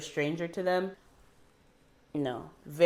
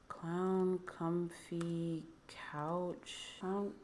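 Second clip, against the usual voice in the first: very soft, very slow, stunned recognition; awed murmur; 12 dB quieter, much slower than usual. Real, not acted. Seeing an old kids' show again, hushed slow awe. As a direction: breathe the name out in disbelief.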